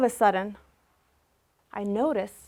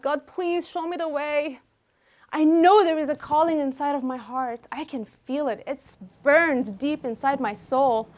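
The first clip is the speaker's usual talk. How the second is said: a higher, pleading prayer, mildly voiced; retold tearful prayer; 4 semitones higher than usual. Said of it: "And I'm crying".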